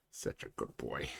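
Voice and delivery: soft unvoiced praise; tender whisper. Real, not acted. Owner whispering praise to his dog. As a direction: murmur it to the dog.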